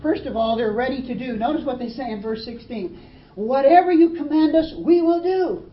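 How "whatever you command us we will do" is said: much higher, louder, ringing promise; eager pledge. Acted. Tribes pledging obedience to Joshua, eager loud promise. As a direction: proclaim it like a keen vow.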